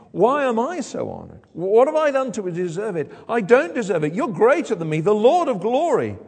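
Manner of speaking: insist, amazed, on being undeserving; awed humility. Acted. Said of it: "she recognizes God has got something special going".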